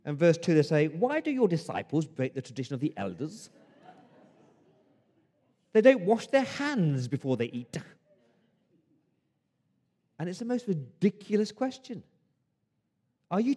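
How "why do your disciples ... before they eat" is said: put-on posh English accent. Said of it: "that really posh English accent".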